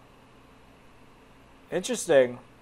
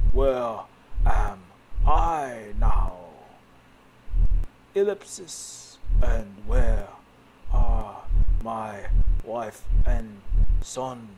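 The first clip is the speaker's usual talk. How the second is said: lower, louder anguished man; dramatic hero voice; 4 semitones lower, 6 dB louder, much slower than usual. Acted. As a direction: ask it like a lost, grieving man. Voicing Jason, lost hero.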